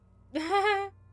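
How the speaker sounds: sudden loud burst of laughter. Real, not acted. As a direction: break out laughing hard.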